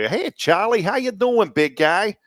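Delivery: high, hearty mock Connecticut greeting; big, high-pitched Connecticut holler; Connecticut accent. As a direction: imitate a jovial Connecticut buddy hailing a pal. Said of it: "He moved from Connecticut".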